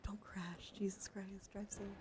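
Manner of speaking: normal talking voice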